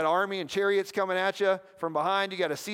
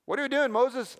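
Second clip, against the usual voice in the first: acted Israelite question; 6 semitones higher, 3 dB louder than usual. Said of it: "Put yourself in Moses' shoes".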